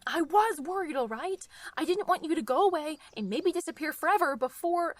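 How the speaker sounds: cornered, half-shouted admission; flustered outburst. Acted. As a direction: blurt it out defensively. Heated confession under pressure.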